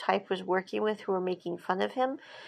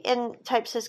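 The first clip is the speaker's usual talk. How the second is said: usual speaking voice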